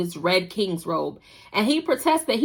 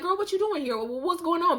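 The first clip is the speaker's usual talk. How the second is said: startled; 8 semitones higher, 5 dB quieter than usual. Retold or imitated. Heejoo startled question.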